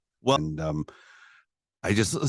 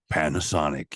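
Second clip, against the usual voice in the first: low, fake ad-announcer voice; put-on deep brand-name voiceover; commercial voiceover; 5 semitones lower than usual. Shown as a demonstration. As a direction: read it like a radio commercial tag. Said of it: "when they do a commercial".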